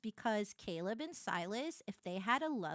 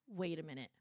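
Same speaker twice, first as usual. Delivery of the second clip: low, soft, suspicious husband voice; imitated husband; 6 semitones lower, 4 dB quieter than usual. Husband seeing her game screenshots, imitated low suspicious voice. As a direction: drop low and quiet, mimicking a baffled husband.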